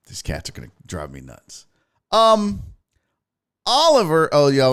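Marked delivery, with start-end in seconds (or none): 0.1-1.7 very soft, low grumble; muttered aside. Real, not acted. Host to self about the cats, low exasperated mutter. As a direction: drop right down and mutter to yourself.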